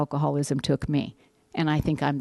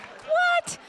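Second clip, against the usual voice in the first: very high, squeaky disbelief; wife's hammed-up you-can't-be-serious; incredulous disbelief; 13 semitones higher, slower than usual. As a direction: shoot up high in mock astonishment. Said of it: "and it's like".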